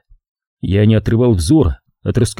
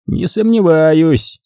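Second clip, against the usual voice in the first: higher, slow and self-satisfied; pompous drawl; 6 semitones higher, much slower than usual. A narrator's reading. Sergeant brushing off the veteran, smug and slow. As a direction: savour each word like a know-it-all. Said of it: "продолжал разглагольствовать фельдфебель".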